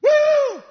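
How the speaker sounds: high, loud joyful whoop; excited whoop. Real, not acted. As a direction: let out a burst of delight. Preacher exults at definition.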